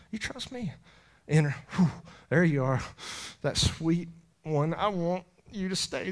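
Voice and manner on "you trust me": soft, hushed; tender. Acted. The Lord speaking tenderly.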